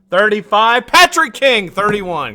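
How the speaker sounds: high, loud excited winner call; raffle caller. Real, not acted. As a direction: shout out the winner, thrilled.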